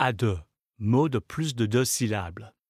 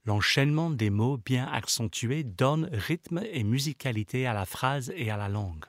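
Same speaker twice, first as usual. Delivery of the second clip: normal talking voice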